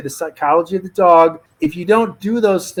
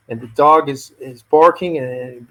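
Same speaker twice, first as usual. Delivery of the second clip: ordinary talk, nothing marked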